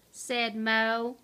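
plain reading voice

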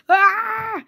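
long high falling scream; play-acted high wail of alarm; mock scream. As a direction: cry out as you get snatched. Luigi being trapped.

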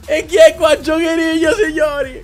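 high, loud, half crying with happiness; screaming with joy. Real, not acted. Gamer overwhelmed by his Maradona pull, joyful screaming.

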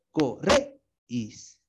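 corréis, slow, with the weight on -réis; stress demo. Shown as a demonstration. Teacher repeating the word for the class, slow stress demo. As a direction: draw out corréis to show the accented E. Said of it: "lleva la tilde en la E".

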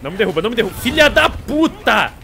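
beg, then explode in rage; frustrated yell. Real, not acted. Begging, then knocked down.

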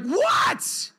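very high, cracked shout back; yelling upstairs, fed up; re-enacted parental outburst; exasperated yell.

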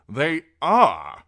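loud, high contradiction; forceful, flat-out rebuttal; insistent. A narrator's reading. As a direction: insist hard, punching "are". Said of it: "insisted Sir Axel".

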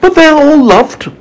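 put-on very high-pitched boy's voice; high, insistent little boy; earnest young James. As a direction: protest gently as a high-voiced storybook child. James replies to the ladybird.

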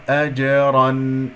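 أجرا slow, ending in a clearly sounded n; drawn-out أجرا with clear final noon; clear noon demo. Shown as a demonstration. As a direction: recite أجرا with tanween fully audible. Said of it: "أسمع صوت النون المظهرة".

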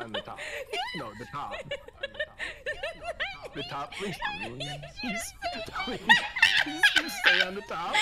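high-pitched laughing plea. Real, not acted. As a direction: plead through giggles. Laughing while pleading.

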